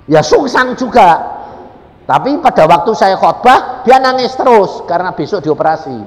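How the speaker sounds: loud, high preacher's delivery; fervent loud sermon voice; preaching at full force. Real, not acted. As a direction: drive the point home to the congregation.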